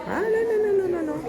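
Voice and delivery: teasing high-pitched denial to the boss; high mock denial. Real, not acted. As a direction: squeak out a playful no.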